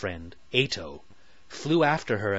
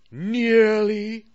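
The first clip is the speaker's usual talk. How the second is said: mock mutter; 11 semitones higher, 7 dB louder than usual. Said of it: "muttered the Queen".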